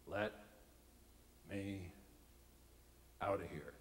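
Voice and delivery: low, quiet, one word at a time; quiet inner plea. Retold or imitated. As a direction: think it under your breath, word by word. Silent thought, deadpan first take.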